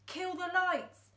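high, slow, firm command; ordered. Acted. Dad ordering the boy during the chase, commanding. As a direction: bark the order to kill the lights. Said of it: "ordered Dad".

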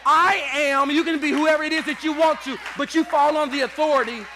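loud, shouted sermon climax; full-force preaching. Acted. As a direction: preach it to the back row.

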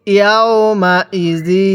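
very slow, drawn-out syllables; model recitation. Shown as a demonstration. Teacher models Quran words for learners, slow measured recitation. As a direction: stretch each long vowel evenly. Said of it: "হ্যাঁ পড়ুন".

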